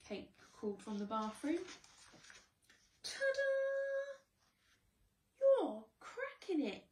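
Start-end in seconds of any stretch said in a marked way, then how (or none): 3.0-6.8 high, loud delighted cheer; excited shout. Acted. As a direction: shout it out with glee. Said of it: "shouted Fish".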